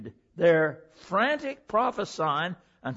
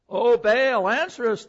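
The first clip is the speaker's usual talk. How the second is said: calling out louder, pitch up; mock shout; 5 semitones higher, 6 dB louder than usual. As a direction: cry out to Baal in mock desperation. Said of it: "they shouted".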